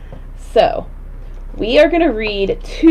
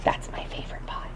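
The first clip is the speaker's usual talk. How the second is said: secret whispered aside; 17 dB quieter than usual. Real, not acted.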